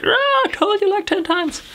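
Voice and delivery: high, put-on voice of a schoolteacher who has said it before; mock nagging teacher. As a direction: squeak it out like a fed-up teacher scolding a pupil. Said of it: "My English teacher from my fifth grade will be like".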